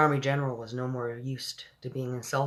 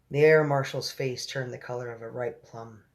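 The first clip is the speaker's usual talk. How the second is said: ordinary delivery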